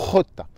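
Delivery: jota with a harsh throat rasp; Spanish jota. Shown as a demonstration. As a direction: scrape the jota from the back of the throat. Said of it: "vous avez la fameuse".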